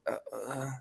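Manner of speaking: soft mock whimper; Triple H. Retelling Triple H wiping a tear, mock crying. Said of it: "wipes a tear away".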